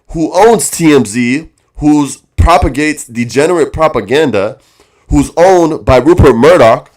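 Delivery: loud, fired-up rant; indignant loud tirade on the owners; heated rant. Real, not acted. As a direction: push it hard, loud and outraged. Angry rant against TMZ.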